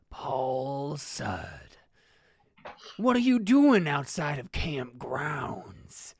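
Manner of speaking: slow, ominous interrogation; menacing old man. Acted. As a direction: draw every word out as a looming threat. Ernest confronts Paul at the gate.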